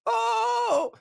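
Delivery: high mock yelp of a stunned commentator; mock shocked commentator. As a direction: squeal it like a commentator caught off guard. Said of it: "He's like".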